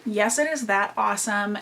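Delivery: ordinary talk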